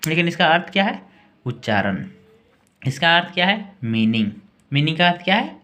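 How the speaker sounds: ordinary talk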